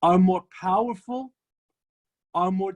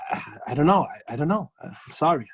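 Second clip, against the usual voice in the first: lower, hesitant apologetic voice; meek Reb Zusha; 5 semitones lower than usual. Retold or imitated. Reb Zusha apologizing to the angry visitor, lower and hesitant. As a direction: stammer it out like a humble sage.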